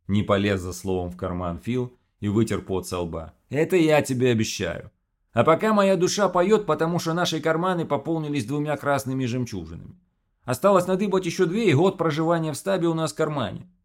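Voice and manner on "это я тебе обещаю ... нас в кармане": high, loud Odessa boast; odessa russian accent. Acted. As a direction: brag in an Odessa singsong. Phil speaks, Odessa-style.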